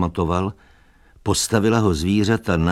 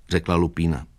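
plain reading voice

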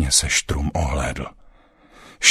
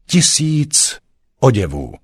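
high, loud and proud; proud councillor, voice raised; proud declaration; 8 semitones higher, 5 dB louder than usual. A narrator's reading. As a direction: proclaim it with all your pride. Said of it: "říci ve dvou slovech všechnu svou hrdost".

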